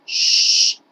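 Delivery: Sh- hissed alone, unvoiced and loud; isolated sh sound. Shown as a demonstration. Teacher drilling the first sound of should, hissed. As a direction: give just the Sh- of should. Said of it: "Listen and repeat".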